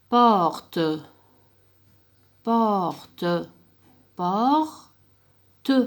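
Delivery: each word said clearly and loud for pupils; slow classroom word modelling. Shown as a demonstration. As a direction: model the word loudly, as a teacher drilling a class. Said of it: "vous passez à la deuxième".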